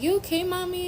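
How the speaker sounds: lightly voiced daughter checking on mom; little daughter asking. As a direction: ask like a worried small child. Daughter voiced in her story.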